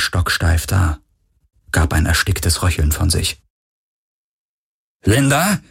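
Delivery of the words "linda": higher, rising questioning call; name called with a rising pitch. A narrator's reading. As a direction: call her name, unsure. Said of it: "rief Parker".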